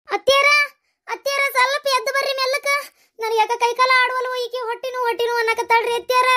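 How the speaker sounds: high, distressed calling; frightened appeal, pitched high; panicked. Acted. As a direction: plead for help in a fluster. Panicked mother calls mother-in-law.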